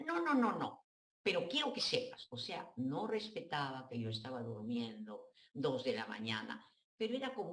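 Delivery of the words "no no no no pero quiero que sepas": insistent mother. Retold or imitated. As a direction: brush off the offer, needy and insistent.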